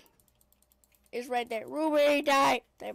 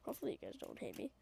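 whispering; 3 semitones lower, 16 dB quieter, much faster than usual. Real, not acted. Whispered aside to viewers.

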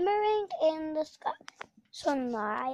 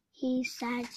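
plain reading voice

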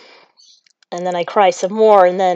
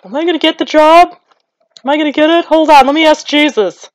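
high, anxious mock fretting; fretting old self; 8 semitones higher, 5 dB louder than usual. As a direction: act out your old self dwelling on it, pitched up. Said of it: "The old me would be, uh, dwelling on it".

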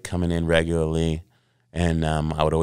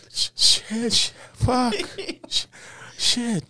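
high panicked swearing; slower than usual. Retold or imitated. After losing the job on the phone, high panicked curses. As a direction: stammer the curses, high and strained.

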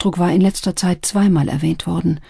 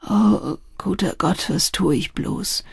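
slow, weary lament; helpless; 3 semitones higher than usual. A narrator's reading. Addict despairs before the maid, helpless. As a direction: draw it out helplessly. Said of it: "fuhr er hilflos fort".

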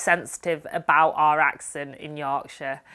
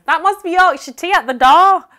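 mock excited; 10 semitones higher, 8 dB louder than usual. Jokey excited sign-off bit.

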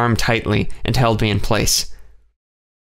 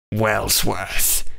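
rasped. A narrator's reading. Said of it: "it rasped".

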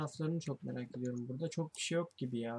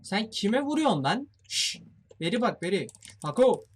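angry challenge; 5 semitones higher, 7 dB louder than usual. Real, not acted. Confronting attacker in game.

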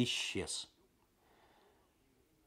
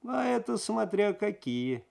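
higher, slow insolent drawl; a suspect stalling the detective with smug insolence; cheeky; 10 semitones higher, slower than usual. Acted. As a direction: stretch it out to provoke. Suspect taunts interrogator.

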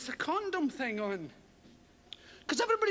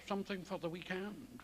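lower, much softer sly offer; barber's murmur; 7 semitones lower, 10 dB quieter than usual. Retold or imitated. Barber slyly offering condoms to a customer, soft sly murmur. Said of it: "That was the line from the barber".

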